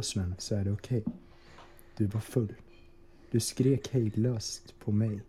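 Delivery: usual speaking voice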